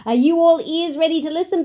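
ordinary delivery, nothing marked

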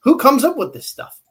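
high, loud mock disbelief; exasperated outburst. Angler ranting about made-up lure terms, loud and exasperated. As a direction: throw up your hands at the silly term. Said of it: "I'm like".

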